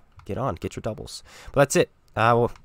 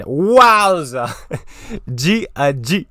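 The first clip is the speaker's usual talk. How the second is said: excited; 7 semitones higher, 9 dB louder, much slower than usual. Real, not acted. After win.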